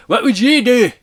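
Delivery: high, loud mock Scottish accent; bad Scottish accent. Reviewer quoting the movie's speech, in a bad Scottish accent. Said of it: "that's how bad the Scottish accent is".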